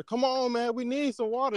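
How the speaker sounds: high-pitched, pleading sales call; wilder street pitch. Acted. Water boys pressing a passer-by to buy, high wild plea. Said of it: "they be a little more wild now".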